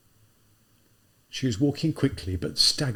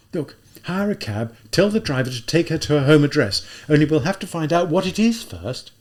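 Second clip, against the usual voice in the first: Raskolnikov urging; 6 semitones higher, 5 dB louder than usual. Acted. Raskolnikov voiced in story.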